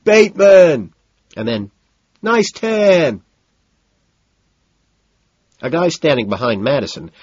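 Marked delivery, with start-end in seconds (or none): 0.0-0.9 high, loud hail; loud call across the club; shouted name. A narrator's reading. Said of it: "shouting, I think". 2.2-3.2 high, loud holler; loud, high call; shouted compliment. A narrator's reading. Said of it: "shouting, I think".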